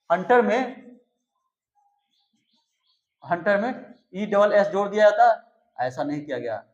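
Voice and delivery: ordinary talk, nothing marked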